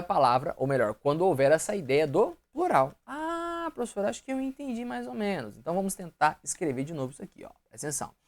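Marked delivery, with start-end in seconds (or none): 3.1-5.6 lightly voiced unsure student, higher and softer; a hint of a confused pupil, pitched up. Teacher voices a student who half understood, mock-unsure.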